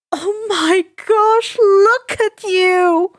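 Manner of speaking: high, loud, slow, near-tearful cooing; gushing coo. Acted. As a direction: melt over the girl, drawing it out. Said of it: "Mitsuki cooed".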